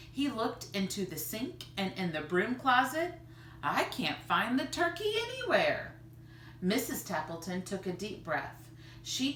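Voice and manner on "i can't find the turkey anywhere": higher, baffled complaint; bewildered. Acted. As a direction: sound lost after searching everywhere. Father searching the kitchen.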